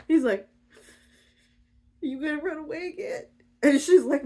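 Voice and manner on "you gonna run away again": small, high, soft question; tiny pleading voice; timid worried voice. Acted. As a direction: ask meekly and quickly. Hurt Orpheus asks timidly.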